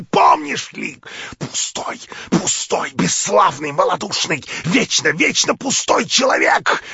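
яростный шёпот. Acted. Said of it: "шипела она своим яростным шёпотом".

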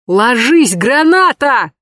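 high, loud panicked yell; grenade warning yell. Acted. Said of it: "заполошно заорал официант".

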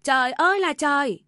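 loud, high cry of outrage; wailing yell. A narrator's reading. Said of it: "Bà Tâm gào lên". (mẹ chồng gào lên than trời)

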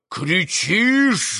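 умеренный злой выкрик; выкрикни с нажимом, но не во весь голос; гневный вопль. A narrator's reading. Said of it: "завопил Митя". (сдержанно сыгранный вопль)

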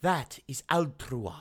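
very low, hushed oracle voice; mock-solemn pronouncement. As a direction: intone it low and soft, with pauses.

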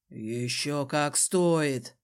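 low, drawn-out man's voice; grimly insistent Desmond. A narrator's reading. Desmond insists on punishing the cousin, grim and low. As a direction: stretch it out, deep and slow.

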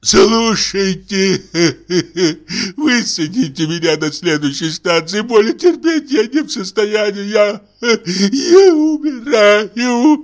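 high, slow sobbing wail; dying invalid wail. Acted. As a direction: moan as if dying. Sick man wails, sobbing. (стонущий умирающий голос)

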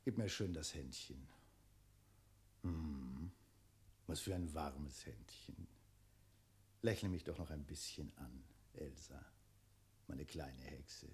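soft, low, sinister sweet talk; creepy tenderness. Acted. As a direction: croon it menacingly soft. Dragon fondles the girl.

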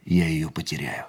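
whispered, low inner thought. Acted. Narrator whispering his private fear to himself. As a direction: breathe out the fear of losing her.

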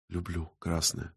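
soft, low near-whisper. A narrator's reading. Woman murmuring to the artist over wine, whispered. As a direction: say it very softly and low, just short of a whisper. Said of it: "прошептала Галя".

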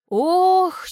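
high, loud "oh" of dismay; dramatic rueful exclamation; exclaimed groan. A narrator's reading. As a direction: groan it out in exasperation. Вздох перед жалобой героини.

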